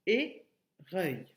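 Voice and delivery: "éreuil" in two separate slow syllables. Shown as a demonstration. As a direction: pause between é and reuil in "éreuil". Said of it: "la deuxième".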